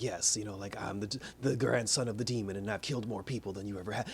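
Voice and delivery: low, soft, brooding mock menace; Damian's put-on voice. Reviewer mocking Damian's edgy voice, low and soft. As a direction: imitate the kid's edgy voice. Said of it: "Why are you talking like that?".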